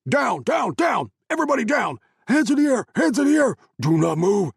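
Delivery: loud, high barked orders; shouting. Acted. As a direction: yell the commands at full force. Soldiers burst in shouting.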